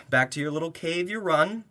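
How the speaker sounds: ordinary talk, nothing marked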